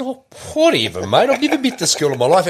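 high, offhand mock denial; retold dismissive brush-off. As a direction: wave it off lightly and high.

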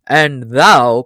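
ordinary delivery

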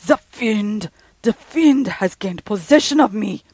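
loud, high frantic shriek; a man losing his mind screams it out. Acted. Student in a frenzy shrieks at the police, frantic loud shriek. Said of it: "he shrieked".

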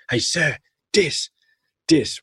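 high and slow "Hey, sir, dis, dis"; imitating kid saying dis. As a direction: call out mid-dance like a delighted boy. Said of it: "he's mid-dance".

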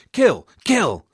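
high, loud acted battle cries; cries of alarm. A narrator's reading. Attackers on the bridge as the Burgundians die, crying out. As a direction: cry it out high, each falling sharply. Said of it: "cries of pain and alarm".

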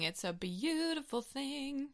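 soft, slow, mock-touched; mock sentimental. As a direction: savour it like a tearful toast.

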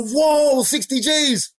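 high, excited whoa; amazed friends. Retold or imitated. Buddies reading the notepad, excited high whoa. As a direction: gasp at the big money. Buddies see the big number.

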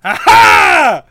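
loud gleeful aha; triumphant shout. Real, not acted. Player's guess is confirmed.

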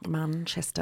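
a quick Mancunian Manchester; Mancunian accent. Shown as a demonstration. Podcast host slipping into Mancunian for Manchester. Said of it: "I'm not gonna do a Mancunian accent. I just did".

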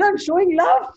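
high-pitched innocent insistence; earnest high protest. Retold or imitated. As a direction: answer high and earnest, defending yourself. His earnest reply, retold.

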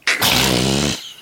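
low burst of laughter; laughing. Real, not acted. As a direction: laugh out at the joke. Laughs at racist joke.

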